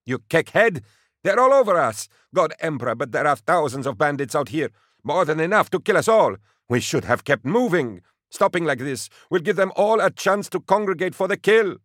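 a driver screaming at the soldier who stopped the convoy; angry rant. Acted. Tuvant rants at Bragg in panic.

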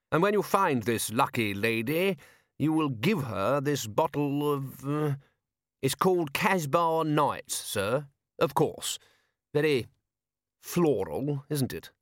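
dry, deliberate lord. A narrator's reading. Patrician questions his corporal about scent, fastidious. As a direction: draw the words out with fastidious care.

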